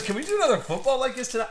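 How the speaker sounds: high, fast eager request; imitating a friend. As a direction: rattle it off like an excited kid. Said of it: "He goes".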